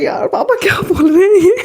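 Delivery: high, whiny mock complaint through a laugh; laughing protest. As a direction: protest to dad, half laughing. Retold embarrassed protest to father. (हँसते हुए शिकायती अंदाज़)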